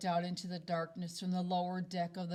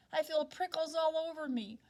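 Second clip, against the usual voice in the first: put-on scared child; 8 semitones higher than usual. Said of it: "I'm scared".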